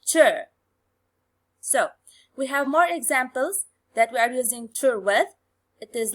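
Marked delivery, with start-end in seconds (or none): 0.0-0.2 "ch" said alone as a model to copy; one isolated, voiced "ch" syllable; demonstrated syllable. Shown as a demonstration. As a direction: sound out "ch" for learners to repeat. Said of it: "Try to say it".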